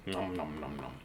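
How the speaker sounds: low, very quiet nom-nom munching; munch softly on imaginary cookies; pretend munching.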